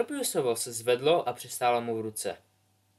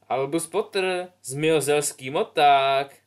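mocking jeer; 3 semitones higher, 6 dB louder, slower than usual. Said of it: "zasmál se někdo".

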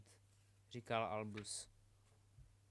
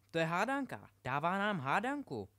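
a louder, excited Albus; eager, raised voice at the riddle; 3 semitones higher, 7 dB louder than usual. A narrator's reading. Albus excitedly tells his friends about the riddle, louder. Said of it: "řekl jim Albus".

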